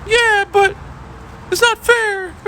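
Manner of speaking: bratty student pleading for recess; whiny kid. Retold or imitated. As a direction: whine and protest like a cheated kid. Student whining.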